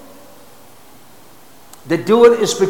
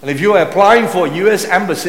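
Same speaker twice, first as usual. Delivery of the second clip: ordinary talk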